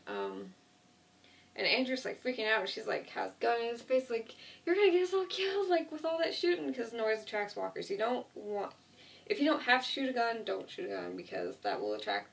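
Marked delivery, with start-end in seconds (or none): 4.7-6.7 high, loud and fast, panicked; frantic yell. Retold or imitated. Andrea, gun in his face, yelling at him in high fast panic. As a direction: snap at him in a panic, voice jumping up.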